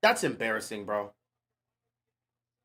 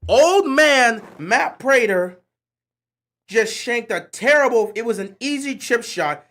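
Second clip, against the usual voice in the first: loud and slow, higher, venting at the kicker; real outrage, louder and higher; furious rant; 4 semitones higher, 8 dB louder than usual. Real, not acted. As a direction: yell it at the TV like he personally wronged you. Fan raging at a missed kick.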